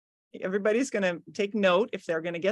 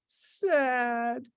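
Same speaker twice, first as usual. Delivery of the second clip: mock sad; 3 semitones higher than usual. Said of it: "selling it like he was an actor".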